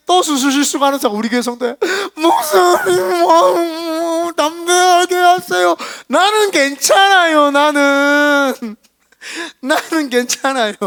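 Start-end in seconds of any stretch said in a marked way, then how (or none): 2.1-7.5 high, loud, slow tearful wail; an imitated crying plea, high and loud; imitating crying nurse. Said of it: "간호사 선생님이". 7.5-10.7 a milder imitated sob, high and slow; tearful nurse, softening. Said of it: "간호사 선생님이".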